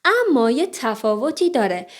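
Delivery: ordinary talk, nothing marked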